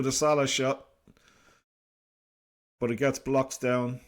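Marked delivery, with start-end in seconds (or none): none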